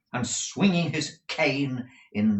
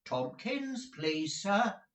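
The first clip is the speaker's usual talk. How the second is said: higher, lightly voiced children; children's chorus; 11 semitones higher, 4 dB quieter, much slower than usual. The boys all naming Tomkins to the master, lightly voiced as children. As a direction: chime in like a group of eager boys. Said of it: "rejoined a chorus of voices".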